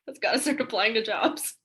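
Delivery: amused aside broken by laughter; laughing. Real, not acted. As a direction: joke it out with a real laugh. Joking remark between laughs.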